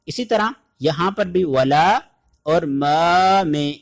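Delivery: usual speaking voice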